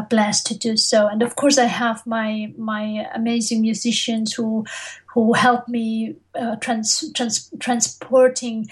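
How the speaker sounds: ordinary talk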